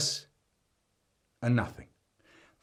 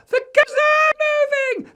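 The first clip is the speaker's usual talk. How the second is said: put-on piercing small child's scream; very high, loud kid's yell; 13 dB louder, faster than usual. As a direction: shriek it out of the window. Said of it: "Eddie was screaming out the window".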